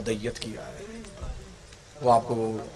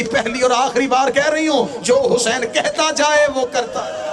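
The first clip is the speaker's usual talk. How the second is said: wailing plea; 11 semitones higher than usual. A narrator's reading. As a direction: cry it out high like a grieving sister. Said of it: "बीबी रो कहती". (बहन अब्बास से रोते हुए ऊँची आवाज़ में विलाप करती है)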